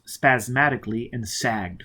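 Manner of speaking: plain reading voice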